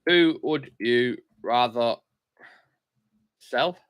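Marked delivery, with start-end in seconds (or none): none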